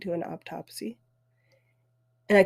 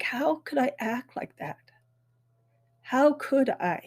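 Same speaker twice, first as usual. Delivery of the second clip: ashamed self-reproach; 5 semitones higher than usual. Retold or imitated. Her own retold self-reproach.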